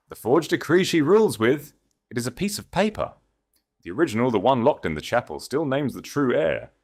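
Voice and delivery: urgent, excited revelation; rising realization. Acted. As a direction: let the truth dawn and surge up. Kalen's dawning realization, rising voice.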